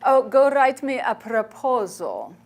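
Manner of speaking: slow, a lightly voiced elderly nun; a hint of Mother Teresa's brisk reply; quoting Mother Teresa. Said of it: "And she said".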